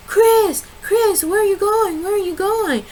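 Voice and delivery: high, desperate calling after him; pleading wife. Retold or imitated. Wife chasing her leaving husband, high pleading cries. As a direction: chase him down with your voice.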